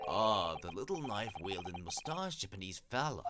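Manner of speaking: police inspector character. Acted. Inspector directing visitors to the lodgings, in character voice. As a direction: point out the lodgings as a chatty inspector.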